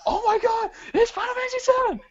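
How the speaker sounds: very high, fast fanboy squeal; excited squeal. Retold or imitated. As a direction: gush in high-pitched delight. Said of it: "They were going like".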